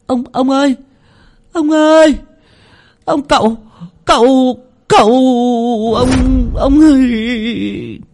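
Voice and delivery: put-on high, stammering boy's cry, words tripping over each other; a terrified boy stumbling over his first words; panicked stammering. Boy Tèo stammering to the old man, high and panicked. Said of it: "lắp bắp".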